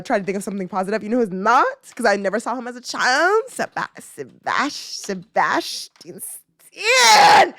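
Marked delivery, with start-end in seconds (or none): 3.5-4.7 low, slow false starts; teasing build-up. Real, not acted. Fan about to name an actor crush, teasing build-up. 5.0-7.5 drawn-out yell, louder and higher; fangirl yell. Real, not acted. Fan naming an actor never seen as a child, yelling. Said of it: "I don't mean to yell in your ear".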